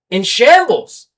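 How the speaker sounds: loud, hard-hit shambles in the middle of a rant; indignant. Real, not acted. Fuming to listeners about old Dodgers mess, loud.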